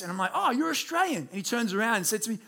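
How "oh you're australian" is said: high-pitched retold "oh" of recognition; imitated surprised greeting; pleasant surprise. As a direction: act out spotting a fellow countryman, pitched right up. Retold surprise at meeting a compatriot.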